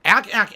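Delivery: mock ack. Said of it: "I don't sound like that when I laugh".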